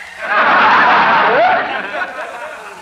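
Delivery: wheezy hissing laugh; wheezing cackle. Acted. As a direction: cackle at your own joke. Professor laughs at his joke.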